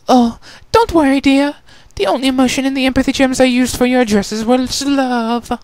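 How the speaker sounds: high, theatrical lady soothing a friend; high-pitched, sugary reassurance; reassuring Rarity. Acted.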